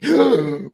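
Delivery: loud, sharp mock gasp; theater gasp. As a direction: imitate a whole audience gasping in shock. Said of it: "the theater gasped".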